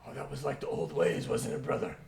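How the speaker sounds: loud, hearty orc among brothers; big nostalgic orc voice; reminiscing orc. Acted. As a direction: reminisce boisterously with a drinking companion. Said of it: "in the conversation, as you're kind of going".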